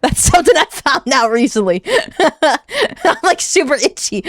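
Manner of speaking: words broken up by laughter; laughing. Real, not acted. Streamer itchy from annoyance, laughing helplessly. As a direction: talk through helpless giggles.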